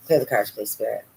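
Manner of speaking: usual speaking voice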